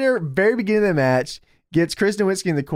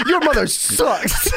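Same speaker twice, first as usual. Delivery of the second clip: slow, higher laughing impression; mock Steiner yell; 8 semitones higher, slower than usual. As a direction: drag it out like a crazed wrestler. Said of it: "Steiner just immediately goes".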